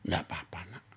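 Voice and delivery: soothing whisper. Retold or imitated. Father comforting his bullied daughter in a soft whisper. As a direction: whisper it like calming a hurt daughter. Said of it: "saya mengajar anak saya".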